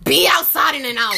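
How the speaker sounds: loud retold order; sudden loud, bossy instruction; yelling. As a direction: raise your voice as if calling after someone. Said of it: "Why are you yelling?".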